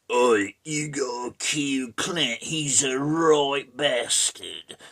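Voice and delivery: slow, drawn-out Cockney cow; mock London accent. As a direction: lean hard on a caricature London drawl. Voicing cows living under London.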